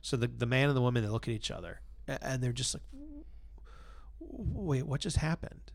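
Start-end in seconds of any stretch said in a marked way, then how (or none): 2.9-5.7 softer, baffled wait what; hushed disbelief after the silence; stunned hush. Retold or imitated.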